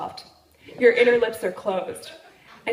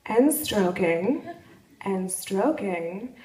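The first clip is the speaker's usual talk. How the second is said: drawn-out slow; slower than usual. Retold or imitated. Describing his tongue to the audience, slow and drawn out. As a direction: savour each stroke, taking your time. Slow retelling of oral sex.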